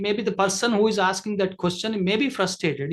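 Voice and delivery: ordinary delivery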